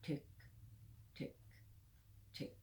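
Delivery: tiny evenly spaced ticks with long gaps; clock ticking. Retold or imitated. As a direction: imitate a clock with short, separate ticks. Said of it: "that it goes".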